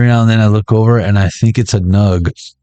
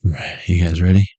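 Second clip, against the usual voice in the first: normal talking voice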